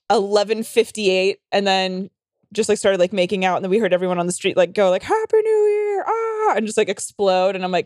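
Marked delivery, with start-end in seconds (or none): 5.0-6.5 high, drawn-out celebratory whoop; long mock party cheer, way up high; imitated crowd cheer. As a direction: mimic revelers calling out from the street.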